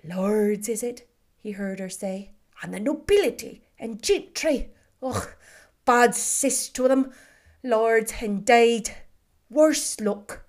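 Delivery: loud Irish grumbling at the gentry; an Irish nurse cursing the lords under her breath but loud; Irish maid bemoaning.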